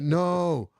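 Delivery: high, drawn-out protest; mock crowd plea. Crowd member pleading for Bob Dell, high mock protest. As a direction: plead the case like one of the crowd. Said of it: "I'd be like".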